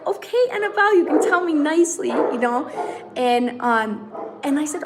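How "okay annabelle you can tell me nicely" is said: much higher, laughing mock rebuke; laughing mock scold. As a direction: tease the daughter through a laugh. Mock-scolding her rude daughter.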